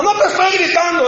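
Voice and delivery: réplica atropellada, mucho más aguda; defiéndete gritando sin darte cuenta, en parodia; grito negándolo. Retold or imitated. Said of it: "Me estás gritando". (gritando a la defensiva, imitado)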